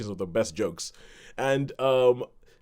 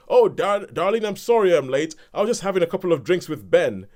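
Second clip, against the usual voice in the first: higher, louder breezy hello to his wife; dad bursting in late to his wife, loud and cheerful; cheery dad greeting; 7 semitones higher, 7 dB louder than usual. Retold or imitated. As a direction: sail in apologising heartily. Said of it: "is like".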